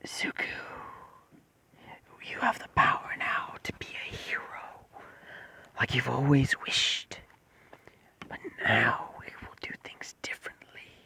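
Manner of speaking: slow, hushed whisper with long pauses; creature voice. Acted. Creature promises Zuko power as the Bat, whispered creature voice. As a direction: whisper it as the creature inside his head. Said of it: "creature says".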